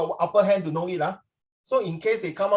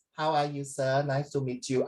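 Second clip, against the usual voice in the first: lightly put-on Vietnamese accent, lower and softer; 4 semitones lower, 5 dB quieter than usual. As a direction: greet softly in a mild Vietnamese accent. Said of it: "so Vietnamese".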